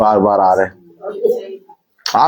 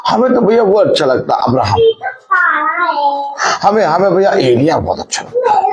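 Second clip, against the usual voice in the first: lightly imitated gushing believer, pitched higher; mock gushing fan; 9 semitones higher than usual. Preacher mimics believers naming favourite prophets, mock gushing fan.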